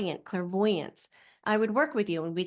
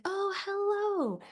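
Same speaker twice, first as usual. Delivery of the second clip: mock sweet customer rep; 7 semitones higher than usual. Customer rep on the phone being nice as all get out. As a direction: play an overly nice rep. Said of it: "And they're like".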